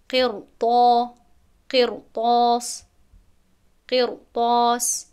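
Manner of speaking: قرطاس slow and loud, ر full; heavy r recitation. Shown as a demonstration. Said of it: "قیر نمیگویم".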